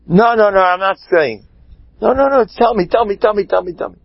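high, rapid-fire repeated protests; agitated refusal and begging. Acted. Rav refusing the curious husband, high insistent back-and-forth. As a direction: trade urgent refusals and pleas, pitch up.

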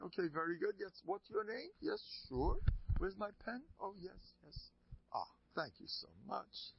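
high-pitched, busy little patter; fussy officiating rabbi. Acted. Rabbi writing the get for the couple, fussy and high. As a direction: play the rabbi busily doing paperwork.

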